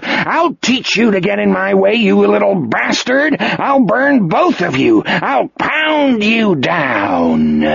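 high, furious threats; raging howl. A narrator's reading. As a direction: howl the threats, stretching out the last one. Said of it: "Morgan howled".

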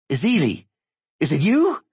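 high, urgent questioning; high-strung man on the phone; anxious caller. A narrator's reading. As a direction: pitch it way up, checking who's there. Anxious caller realizes no hello yet.

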